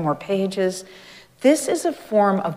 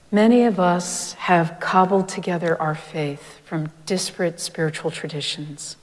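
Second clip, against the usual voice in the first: ordinary delivery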